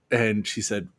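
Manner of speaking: ordinary talk, nothing marked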